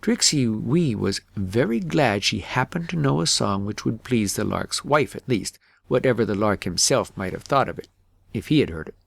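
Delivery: plain reading voice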